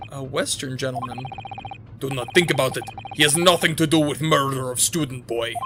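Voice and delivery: put-on gruff Russian-accented guard, proud; guard showing off the first-class cabins, Russian accent. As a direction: boast like a self-important Russian guard. Sailor voice.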